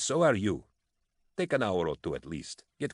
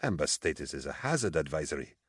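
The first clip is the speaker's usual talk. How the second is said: mystified; 2 semitones lower, 5 dB quieter than usual. A narrator's reading.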